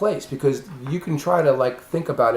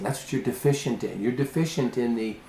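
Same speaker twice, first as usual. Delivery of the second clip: ordinary talk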